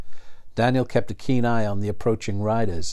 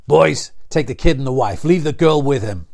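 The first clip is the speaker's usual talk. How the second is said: loud command to his men; barked order; 4 semitones higher, 8 dB louder than usual. A narrator's reading. Outlaw leader orders his men to seize the family, loudly. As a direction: order the hostages taken.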